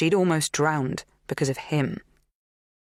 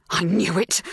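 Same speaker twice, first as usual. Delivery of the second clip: trembling voice; 3 semitones higher than usual. A narrator's reading. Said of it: "her voice trembling with barely suppressed emotion".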